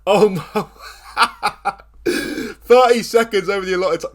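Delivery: giddy laugh through words; laughing in disbelief. Real, not acted. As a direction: laugh while talking, stunned. Laughing in disbelief after goal.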